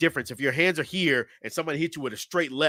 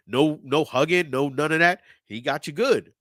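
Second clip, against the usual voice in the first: ordinary talk, nothing marked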